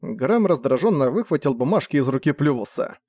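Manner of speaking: usual speaking voice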